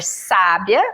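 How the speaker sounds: "sábia" with the first syllable SÁ stressed. Shown as a demonstration. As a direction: lean on SÁ in "sábia", slowly. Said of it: "a primeira sílaba". ("sábia" com a primeira sílaba tônica)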